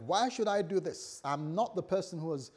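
acted protest building to a loud, high finish; indignant protest. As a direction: argue back as the wronged one, rising to a shout. Acts out the wronged person protesting.

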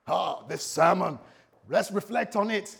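slow, loud, hammed-up self-important preacher; a slow and loud mock reflection on the sermon; mock pious sermon voice. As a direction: play the pious pastor ignoring his wife, ham it up.

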